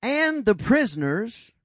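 slow, drawn-out storyteller; slow, hushed Bible storytelling; dramatic storytelling. Shown as a demonstration. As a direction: paint the scene word by word. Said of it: "let's kinda get a picture of this".

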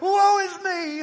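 high, slow, loud hammed-up lament; mock wail. Preacher mimics a believer whose car won't start with a high, slow, hammed-up wail. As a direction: wail it like a melodrama.

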